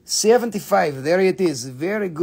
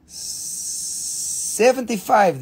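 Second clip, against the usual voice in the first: drawn-out price; much slower than usual. Acted. Dramatic price reveal.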